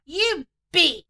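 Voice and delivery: mock furious shout. Sister-in-law furious at narrator.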